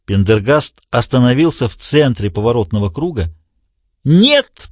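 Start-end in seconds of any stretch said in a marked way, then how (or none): 4.0-4.7 high, strained desperate "Нет!" in a villain's voice; отчаянный крик; desperate cry. A narrator's reading. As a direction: cry out in despair, pitched way up. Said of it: "послышался отчаянный голос".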